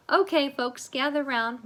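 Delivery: librarian addressing class. Acted.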